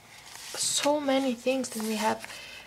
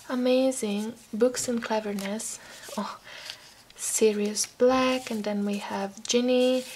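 ordinary talk

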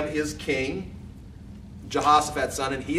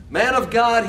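raised, louder appeal; begging; 8 semitones higher, 6 dB louder, slower than usual. A narrator's reading. Third captain kneeling before Elijah, begging. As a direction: call out to him in a mildly pleading way. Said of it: "he begged".

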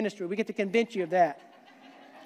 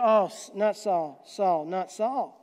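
slow, sinking dread; mock despair over the king; slow groaning mock dismay; 4 semitones lower, slower than usual. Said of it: "one of those that go".

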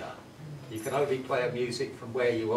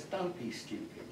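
scoffing husband; 6 semitones higher, 6 dB quieter than usual. Acted. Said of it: "And he says".

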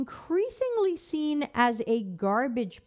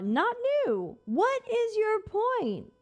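exasperated; 10 semitones higher, slower than usual. Real, not acted. Exasperated rant at article.

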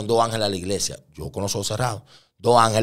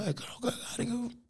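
very high, hushed impersonation; imitated woman, half-whispered; woman's questioning; 13 dB quieter than usual. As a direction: softly squeal a puzzled question. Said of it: "Ella me dijo".

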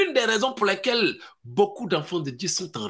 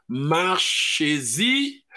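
normal talking voice

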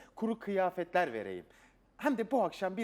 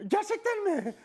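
much higher, louder thrilled question; thrilled disbelief; 5 dB louder than usual. Acted. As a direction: squeal it in disbelief. Wish granted, asks again.

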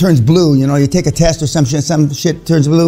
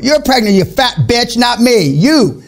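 higher-pitched, indignant jab; mock angry retort; 6 semitones higher, slower than usual. Man rejecting "we're pregnant" to the mother, mock angry. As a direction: throw it back at them in mock anger.

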